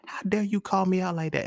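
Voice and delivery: high-pitched mock offence at normal volume; playful indignation. Man conceding to the woman who called him out, playfully indignant. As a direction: protest in a high voice, without shouting. Repeats comeback, conceding she was right.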